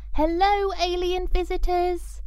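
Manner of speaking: put-on high, eager girl calling across the garden; called out. Coco steps forward and calls out to the aliens. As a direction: greet them brightly from afar. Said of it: "she called out".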